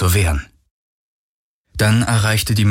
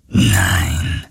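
much lower, breathy ghost murmur; 12 semitones lower than usual. Acted. The ghost voice answers Galen, low and murmuring. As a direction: breathe it out like a hidden phantom. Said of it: "raunte die Geisterstimme".

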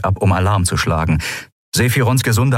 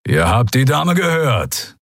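growling; 5 semitones higher, slower than usual. A narrator's reading. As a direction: growl it as a warning. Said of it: "knurrte Keru".

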